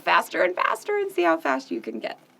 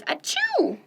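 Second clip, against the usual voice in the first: sneeze; 7 semitones higher than usual. Acted. Duck sneezes in story.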